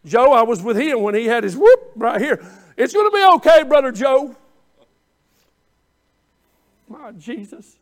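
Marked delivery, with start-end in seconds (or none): 2.8-4.4 high, loud mock comfort; overdone easy encouragement; glib reassurance. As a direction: cheer someone on from a safe distance. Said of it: "it's easy for me to stand on the outside".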